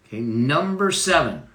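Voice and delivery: usual speaking voice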